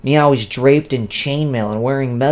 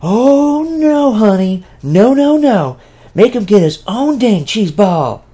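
sassy, much higher and louder; said loudly; 10 semitones higher, 5 dB louder than usual. Acted. Viet loudly scolds the fairy, high and sassy. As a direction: camp outrage, loud and high. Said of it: "said loudly".

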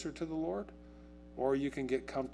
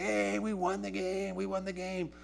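sing-song mock celebration, pitched up; celebrating player; 7 semitones higher than usual. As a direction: crow over the win like a kid after the first game. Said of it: "Would you be still celebrating".

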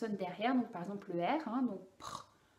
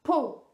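"pot" with the p popped out, louder, lips bursting; crisp p syllable; 4 semitones higher, 8 dB louder than usual. Shown as a demonstration. Therapist testing the child, exploding p. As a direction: pop the p of "pot" like a small explosion. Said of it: "je te dis".

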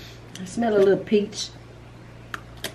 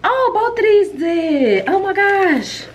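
much higher and louder, squealing with delight; 11 semitones higher, 4 dB louder, slower than usual. Real, not acted. Vlogger opening a viewer's package, high excited squeal. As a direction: gasp it out high, thrilled by the gift.

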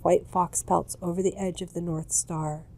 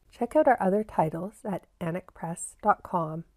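a lightly read social media plug; promo outro. Acted. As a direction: run through the links like an ad. Promo read after the story.

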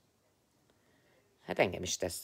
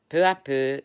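declaimed French phrase; 5 semitones higher, 11 dB louder than usual. Real, not acted.

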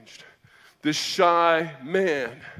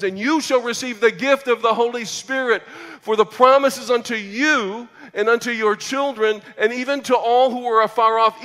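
preaching Peter's words; 6 semitones higher, 7 dB louder than usual. Acted. Preacher quoting Peter's sermon.

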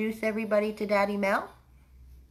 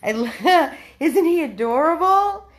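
laughing gush; 7 semitones higher, 5 dB louder than usual. Real, not acted. Gushing to viewers about the dog, laughing. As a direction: laugh through it, loud and delighted.

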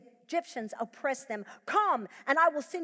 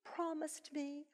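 imagined lament climbing higher to a pained peak; regretful believer; 6 semitones higher, 7 dB quieter than usual. Pretend. Preacher voicing a regretful believer at life's end, rising lament. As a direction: voice the disappointed soul at life's end. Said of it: "we could get to the end of our lives and go".

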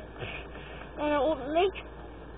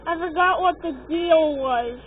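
ordinary talk

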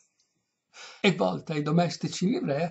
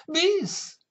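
high, loud call for an encore; old noblewoman calling out sharply high; lightly voiced cavernous old lady; 5 dB louder than usual. A narrator's reading. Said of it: "disse con la voce cavernosa".

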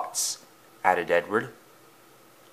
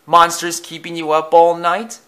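high, teasing question; chuckling tease; 7 semitones higher, 4 dB louder than usual. A narrator's reading. James teases tired Thomas about monsters, chuckling tease. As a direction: tease him, pitched up. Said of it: "he chuckled".

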